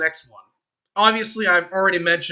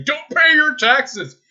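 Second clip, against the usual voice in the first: high, loud and slow, each word drawn out; mock anti-tax rant; 10 semitones higher, 7 dB louder, slower than usual. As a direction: ham up a tax-dodger's slogan. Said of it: "I'm not one of those people that say".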